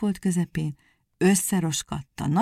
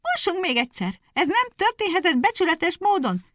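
high, indignant hare cry; shouted; 12 semitones higher, 3 dB louder than usual. A narrator's reading. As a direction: cry out at the cheat. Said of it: "kiáltott a nyúl".

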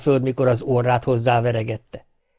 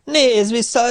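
high, loud mocking call; taunting shout; 12 semitones higher, 4 dB louder, slower than usual. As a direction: jeer at the fleeing devil. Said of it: "eleget kiabálta". (magas, hangos gúnyos kiáltás)

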